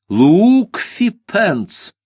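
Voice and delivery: slow, high dramatic announcement; dramatic reveal. A narrator's reading. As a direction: draw out the name ominously.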